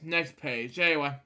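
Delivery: plain reading voice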